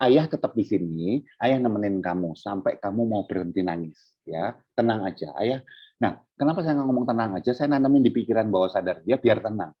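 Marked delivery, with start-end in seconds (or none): 0.1-5.7 put-on low, soft reassurance to a crying child; gently calm the child down, voice lowered; imitating a dad's quiet comforting; soothing father.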